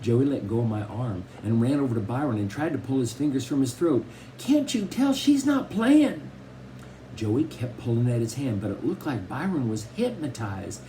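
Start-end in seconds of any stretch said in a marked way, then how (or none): 4.4-6.2 put-on very high, drawn-out little-girl plea; desperate pleading. A narrator's reading. Little sister pulls at frozen brother's hand, desperately pleading. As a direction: stretch it out high and urgent.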